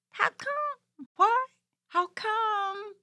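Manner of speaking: squeaky high questioning; high puppet voice. Acted. Puppet Corona pressing the host, high and curious. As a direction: ask like a tiny curious puppet.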